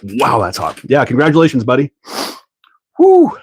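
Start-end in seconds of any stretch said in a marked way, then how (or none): 3.0-3.4 high, loud celebratory woo; real happy whoop; cheer. Real, not acted. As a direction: whoop for the new dad. Cheering friend's new baby.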